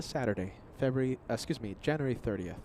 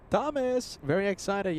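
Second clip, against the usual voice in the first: higher, excited repeat; 7 semitones higher than usual. Real, not acted. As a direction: echo the excitement upward. Echoing viewer's excitement.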